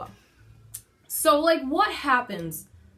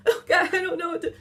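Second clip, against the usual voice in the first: mock panic; 8 semitones higher, 4 dB louder, faster than usual. Imitating panicked partner with baby.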